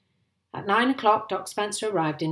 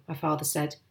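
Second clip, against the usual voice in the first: usual speaking voice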